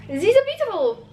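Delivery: high-pitched Russian-accented film line. Shown as a demonstration. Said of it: "when the accent actually came out".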